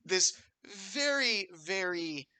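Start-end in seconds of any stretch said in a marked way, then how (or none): none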